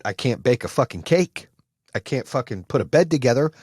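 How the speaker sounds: ordinary talk, nothing marked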